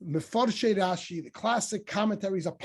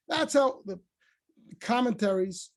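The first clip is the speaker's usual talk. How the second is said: normal talking voice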